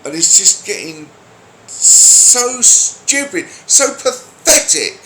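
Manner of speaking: high, loud and fed up; exasperated rant. Real, not acted. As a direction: let the frustration burst out. Ranting about people taking offence.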